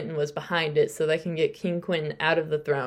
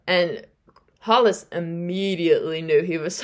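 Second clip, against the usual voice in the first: ordinary talk, nothing marked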